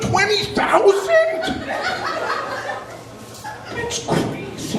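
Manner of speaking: very high, slow, stunned falsetto; shocked squeak. Pretend. Comic reacting to a student's tuition, squeaky mock shock. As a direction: squeal in drawn-out disbelief.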